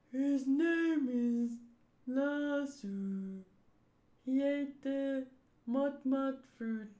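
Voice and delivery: very slow, drawn-out dopey voice; Mister Four. Acted. Slow batter explains his gun dog, ultra-slow drawl. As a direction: stretch each word out with long gaps. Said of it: "So then I have to say it like this".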